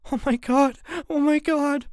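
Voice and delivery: high, repeated frightened gasping words; panicked sister. A narrator's reading. June on her bunk, panicking high. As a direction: panic and repeat it under your breath.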